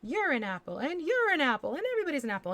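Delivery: high, loud, swooping pitch on each one; hammed-up giveaway. Painter declaring shapes to be apples, playful swooping announcement. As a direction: announce it like handing out prizes to everyone. Hands out apples like game-show prizes.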